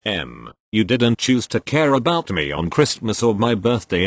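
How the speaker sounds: ordinary delivery, nothing marked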